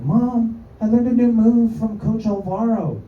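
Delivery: put-on high, excited little kid; a lightly high child voice. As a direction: voice of a young boy telling his mom. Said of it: "I gotta work on my fucking kid voice".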